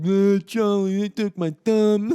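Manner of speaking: high, loud imitated scream; hammed-up howl of pain; Paulie screaming. Imitating drugged Paulie screaming to Charlie, loud wailing. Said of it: "screaming, doing his whole".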